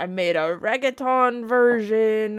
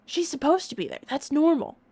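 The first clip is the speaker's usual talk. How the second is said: imitating an onlooker; 8 semitones higher than usual. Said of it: "Just be like".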